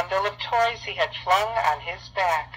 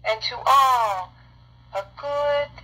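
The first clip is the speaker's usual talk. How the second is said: high, loud and slow Christmas farewell; ringing slow goodbye called from afar; joyful exclamation; 7 semitones higher, 4 dB louder, slower than usual. A narrator's reading. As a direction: exclaim it out over the rooftops. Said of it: "I heard him exclaim".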